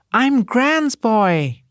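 put-on high, slow small child's voice; little boy's voice. A narrator's reading. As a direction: say it like a tiny boy proud to belong to his gran. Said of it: "And a little voice, so warm".

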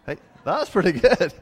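chuckle, pleased with the bad accent; laughing at himself. Real, not acted.

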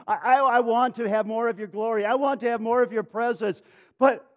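high, urgent, stammering entreaty; impassioned acted begging; desperate pleading. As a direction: plead insistently, piling wish on wish. Acts Peter pleading with Jesus.

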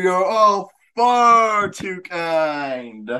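high, slow and grandly pompous; pompous gracious champion. Pretend. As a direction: lord it over them like a king. Winner gloats, mock royal.

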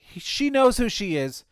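sudden high, loud jab; pointed outburst. Real, not acted. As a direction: burst out accusingly at someone unnamed. Pointed jab at unnamed accuser.

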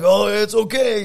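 high, loud, drawn-out forgiveness; mock pained reassurance. Hurt wrestler forgiving his friend mid-match, strained. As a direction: strain out that all is fine, slowly.

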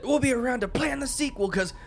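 quoting him high and loud, in character; high put-on movie voice. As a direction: ham up the sequel line. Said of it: "he said".

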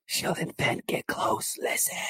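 breathy unvoiced whisper; hushed whisper. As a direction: whisper it so no one hears. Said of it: "this is in hushed tones".

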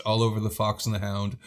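ordinary talk, nothing marked

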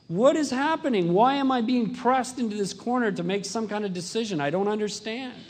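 high, loud bewildered protest; angry pleading. Retold or imitated. A frightened man protesting being pressed into a corner, angry. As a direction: demand answers you can't get. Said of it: "And I was really angry about it".

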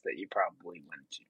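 hushed, breathy aside; whispered aside. Real, not acted. Aside whispered while talking.

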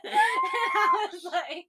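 high, loud laughing fit, nearly crying; crying with laughter while talking; helpless laughter. Real, not acted. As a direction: laugh until you can't breathe, words squeezed out. Laughing so hard she cries.

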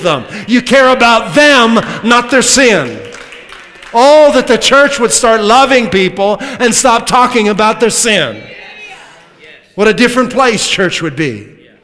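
loud, rising preacher's cry; preaching. Acted. Preacher pleading with the church, full-force preaching. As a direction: preach it out to the whole room.

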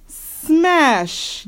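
high-pitched little yell. A narrator's reading. Honey copying Sister at breakfast, high yell. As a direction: pipe it up high, like a small child yelling. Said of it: "yelled Honey".

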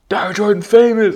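put-on high, loud girl calling out; imitated shout. Girl behind him on the bridge, shouting. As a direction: call it out like an excited fan. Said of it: "people were shouting at me".

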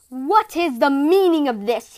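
slow, loud angry father roar. A narrator's reading. Father finding his daughter with an earthling, roaring. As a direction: bellow as the furious father. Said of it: "he roared".

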